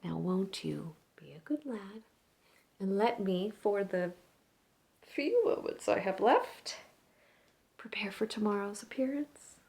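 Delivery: hushed, near-whispered stage monologue with long pauses; opera singer, gently pleading. Acted. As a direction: let the diva soften to a breath, rising briefly to urge the young fan.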